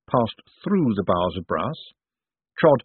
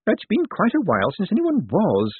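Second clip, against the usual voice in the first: high-voiced old lady's wistful remark; sighing Topsy; 12 semitones higher than usual. A narrator's reading. Dead woman laments to Death, high and wistful. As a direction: lift it high, a touch of a sigh. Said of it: "sighed Topsy".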